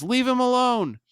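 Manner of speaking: high, loud protective yell. Retold or imitated. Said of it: "Ryan yells".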